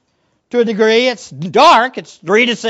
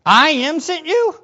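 high, rising echo of disbelief; puzzled question; 7 semitones higher than usual. Acted. Hearer questioning Moses' message, puzzled. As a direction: ask it back, incredulous.

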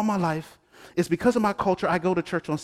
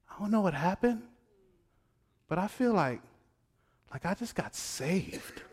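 hushed wonder; 11 dB quieter than usual. Retold or imitated.

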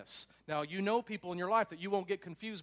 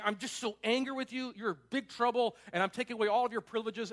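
raised, higher scolding parent voice; imitating an angry parent taking away privileges; mock angry parent; 5 semitones higher, 5 dB louder than usual. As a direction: scold the kid in a put-on parent voice. Parent scolding kid out of nowhere.

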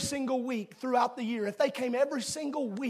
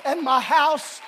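resolute father; 3 semitones higher, 10 dB louder than usual. Acted. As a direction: proclaim it like a steadfast father. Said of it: "square their shoulders and say".

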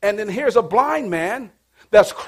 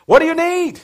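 higher, louder, ringing out; bold Jesus line; 8 semitones higher, 5 dB louder than usual. Acted. Preacher to congregation, ringing out Jesus' question. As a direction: proclaim the question to the crowd. Repeated loud after laugh.

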